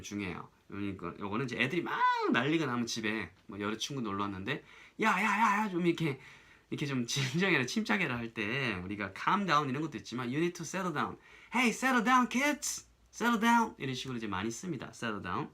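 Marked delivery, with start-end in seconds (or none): 5.0-8.2 high, urgent calls to get attention; parent calling out. Acted. Parent to rowdy children at home, calling out. 11.5-13.7 put-on loud, high-pitched call to rowdy kids; parent calling out. Parent to kids and their visiting friends, calling out.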